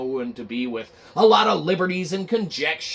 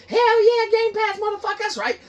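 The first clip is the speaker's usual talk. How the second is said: party cheer; 4 dB louder than usual. Acted. Staff partying, mock party cheer.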